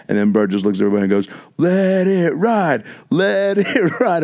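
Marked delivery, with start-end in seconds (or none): 1.6-4.2 high, very slow, drawn-out voice; high, very slow "let it ride" with a laugh slipped in. Retold or imitated. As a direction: stretch every word out high, egging on the gamble. Comedian [name] urging another bet.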